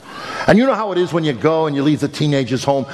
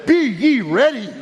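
higher, slow, chuckling refrain; preacher answering his son; laughing punchline; 7 semitones higher, slower than usual. Acted. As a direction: deliver the scripture as a grinning punchline.

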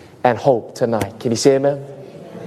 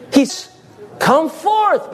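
true call; 10 semitones higher, much slower than usual. Shown as a demonstration. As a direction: call him out of the grave. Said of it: "because where was he?".

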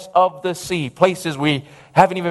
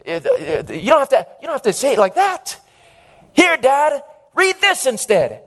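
mildly flustered, higher-pitched protest; acted-out exasperation with a parent; embarrassed son; 5 semitones higher than usual. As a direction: scold dad in halting, embarrassed starts. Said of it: "that embarrassed the son".

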